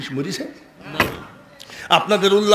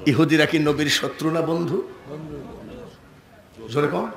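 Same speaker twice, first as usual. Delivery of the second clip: normal talking voice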